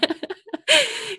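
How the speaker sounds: high teary laugh; tearful laugh. Real, not acted. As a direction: laugh through tears. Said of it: "I get a bit teary".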